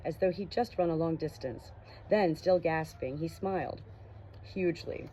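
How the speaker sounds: steady audiobook reading voice; story narration. A narrator's reading. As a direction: read the chapter like an audiobook. Reads novel chapter aloud.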